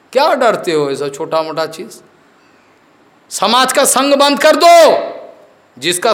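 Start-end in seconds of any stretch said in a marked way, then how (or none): 3.3-5.0 raised, loud command to the end; forceful exhortation. Real, not acted. Guru ordering disciples to give up society, loud exhortation. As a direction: drive the order home at full voice. Preacher commands renouncing company.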